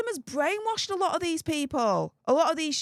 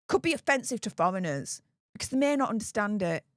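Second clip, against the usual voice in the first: ordinary delivery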